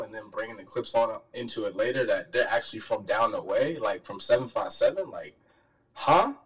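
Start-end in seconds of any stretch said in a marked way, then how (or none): 5.9-6.3 high, loud incredulous "huh?"; mock disbelief. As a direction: re-enact being stunned by the news. Re-enacts his mind-blown reaction.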